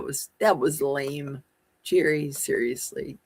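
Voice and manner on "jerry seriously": ordinary delivery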